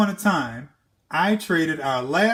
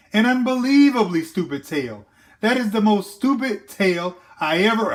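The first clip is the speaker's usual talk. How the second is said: rising rant; 6 semitones higher, 3 dB louder, much faster than usual. Acted. Jack's rant building.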